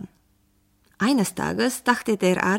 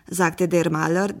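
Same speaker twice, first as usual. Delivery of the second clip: ordinary delivery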